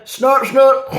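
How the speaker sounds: loud, high cartoon snorts; comic snorting. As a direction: snort it out, hammed-up. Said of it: "that little rendition of all my skills".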